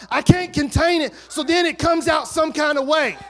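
loud, rising preacher's call with shouts; full-force preaching. Acted. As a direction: preach it out to the whole church.

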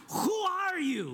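very high, slow Princess Bride accent. Shown as a demonstration. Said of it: "I'm gonna do it one more time".

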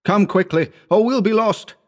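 high, pressing alarm; frantic urging. A narrator's reading. Howard hurrying his friend through fog, frantic urging. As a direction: urge them on in a high panic. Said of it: "he urged frantically".

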